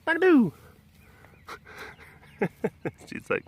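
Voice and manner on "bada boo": high, loud sing-out. Real, not acted. Playfully cooing nonsense to a pet, high baby-talk. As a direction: pipe it up high and louder.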